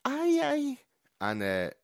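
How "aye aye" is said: mock Nordic grunt.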